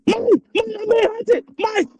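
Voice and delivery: high, desperate shouts for help; terrified yelling. Real, not acted. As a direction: scream for your friend. Ghost hunting him, yells.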